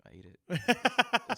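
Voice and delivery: high, loud, slowly drawn-out admission; mock guilty confession. Confessing to girlfriend about the eaten food, mock guilty. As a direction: stretch it out high, comically owning up.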